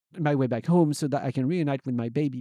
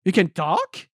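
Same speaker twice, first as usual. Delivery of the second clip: loud, higher-pitched question; startled rising question; astonished exclamation; 5 semitones higher, 7 dB louder, slower than usual. Acted. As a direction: exclaim it in disbelief. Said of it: "I exclaim".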